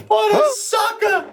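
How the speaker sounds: very high-pitched, incredulous jeer; mocking exclamation. Real, not acted. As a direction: crow it at the top of your range. Punchline mocking the friend.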